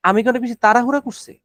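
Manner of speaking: normal talking voice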